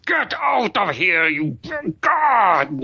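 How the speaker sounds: high, strained outburst in broken bursts; breathless cursing. Acted. Winded man swearing at his rescuer, high strained curses. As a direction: swear in half curses, cut off to suck in air. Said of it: "as soon as he has enough air to, to speak".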